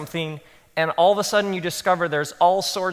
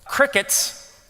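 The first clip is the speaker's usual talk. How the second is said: high-pitched aside; 7 semitones higher than usual. Shown as a demonstration. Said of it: "I would call this one".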